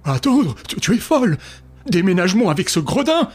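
loud, high indignant outburst; outraged. Acted. Father raging at his daughter about the thief, loud outburst. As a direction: sputter with outrage.

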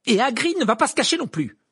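high, loud, determined; in a rage. A narrator's reading. Hermione resolves to bring Hagrid out, raging. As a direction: announce it with furious resolve. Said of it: "dans une rage semblable".

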